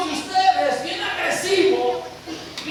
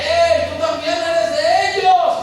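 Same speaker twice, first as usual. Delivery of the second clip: louder, slow calling out; loud accusation; 3 semitones higher, 7 dB louder, slower than usual. Acted. Second woman accusing Peter, loud and slow. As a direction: call him out in front of all.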